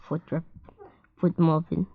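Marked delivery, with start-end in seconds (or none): none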